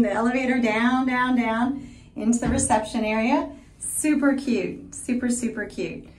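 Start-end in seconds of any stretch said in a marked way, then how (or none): none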